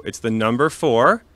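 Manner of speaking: slow, careful spelling of "Table four T W O"; spelling out the code. Shown as a demonstration. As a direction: spell each letter clearly and slowly. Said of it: "the letters T W O".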